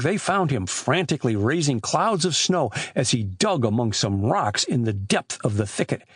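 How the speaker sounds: ordinary delivery, nothing marked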